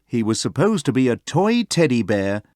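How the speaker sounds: ordinary delivery, nothing marked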